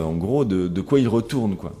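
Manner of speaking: usual speaking voice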